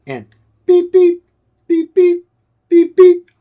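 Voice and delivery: high, loud mock heart-monitor beeps; sharp electronic beeping noises; imitated monitor beeps. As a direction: beep like a hospital monitor coming back to life.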